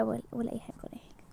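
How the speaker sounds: low, whispered dismissal; very soft, low murmur; backing off. Acted. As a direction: take it back under the breath, fading away. Backing off a remark.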